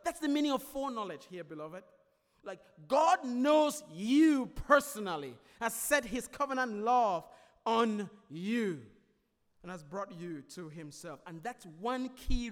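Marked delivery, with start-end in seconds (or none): 2.9-8.9 fervent preaching. Acted. Preacher stressing the key point.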